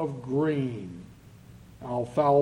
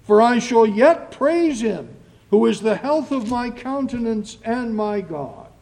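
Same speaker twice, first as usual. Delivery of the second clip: loud, high, fervent scripture reading; declaimed psalm with big swells on key words; psalm proclamation; 6 semitones higher, 8 dB louder than usual. Acted. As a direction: cry the psalm out from the pulpit.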